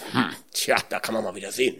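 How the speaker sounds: low, soft aside; quiet, trailing-off mock response; muted retold reaction. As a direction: mutter it low, as if to yourself. Retold muttered reaction to praise.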